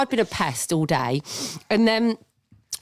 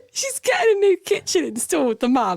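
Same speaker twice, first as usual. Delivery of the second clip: high, giggling voice that keeps cracking; fighting the giggles while reading; laughing; 12 semitones higher than usual. Real, not acted. Reading the letter aloud to her friend, helpless laughter. Both crack up over the mams.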